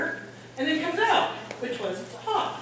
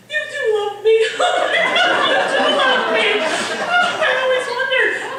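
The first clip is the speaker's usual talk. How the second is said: high, loud, overcome with love; hammed-up swooning outburst; mock gushing; 10 semitones higher, 8 dB louder, slower than usual. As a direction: gush loudly as if deeply touched. Mock tearful gushing to rescuing husband.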